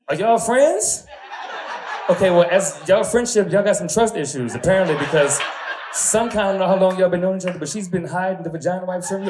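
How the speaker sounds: high, loud teasing; loud, high, playful crowd ribbing; teasing excitement. Real, not acted. Teasing the audience.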